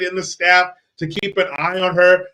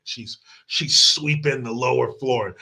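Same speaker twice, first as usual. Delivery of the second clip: whisper; 6 semitones lower, 6 dB quieter than usual. Retold or imitated.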